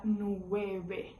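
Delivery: "nueve" slowly, with b in place of v; Spanish b for v. Shown as a demonstration. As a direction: say "nueve" as nweh-beh. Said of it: "pronuncia ele como se fosse um B".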